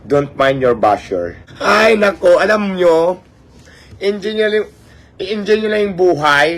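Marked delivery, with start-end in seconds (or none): 1.6-6.5 high, bright mock-sweet telling-off; sassy teasing. Real, not acted. Vlogger teasing her bashers, high and sassy. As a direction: tease the haters from up high.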